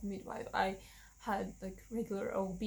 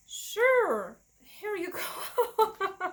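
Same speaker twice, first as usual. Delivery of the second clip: much higher and louder, slow, laughing through it; eager laughing offer; 9 semitones higher, 7 dB louder, much slower than usual. Retold or imitated. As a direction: mock-cheerfully hand the baby over. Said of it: "And I'm like".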